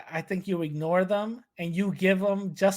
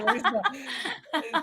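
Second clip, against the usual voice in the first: slow, laughing, higher; 7 semitones higher, 4 dB quieter, much slower than usual. Real, not acted. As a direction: break off, cracking up. Laughing cut-off.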